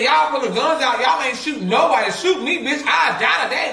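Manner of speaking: imitating cousin Deidre daring the gunmen; cousin's mock dare. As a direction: dare them to shoot, half joking. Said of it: "Deidre said".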